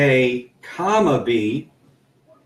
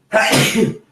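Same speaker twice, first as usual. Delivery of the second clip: a real sneeze mid-lesson; sneezing; 8 semitones higher than usual. Real, not acted. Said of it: "I'm sorry for the sneeze".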